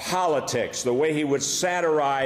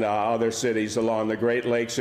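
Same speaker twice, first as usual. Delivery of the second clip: ordinary talk, nothing marked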